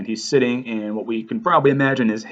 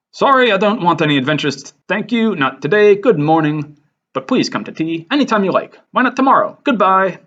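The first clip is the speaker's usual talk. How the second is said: higher, louder, hurried polite brush-off; Bilbo Baggins; 7 semitones higher, 4 dB louder than usual. Acted. Bilbo turning down an adventure, flustered and high. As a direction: voice a flustered hobbit brushing off visitors. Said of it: "This, of course, is Bilbo Baggins".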